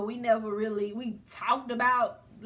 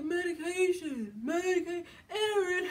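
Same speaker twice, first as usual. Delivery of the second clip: put-on drunken laughing; 5 semitones higher than usual. Imitating the drunk man dodging her question, laughing all over the place. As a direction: imitate someone rambling and cracking up. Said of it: "he just laughing and going all over the place".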